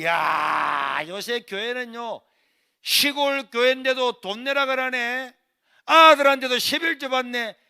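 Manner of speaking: loud, high jeering rant; mocking the church. Older brother mocking the church over tithes, loud jeering shout. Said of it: "이런 막 교회를 욕을 하는 거".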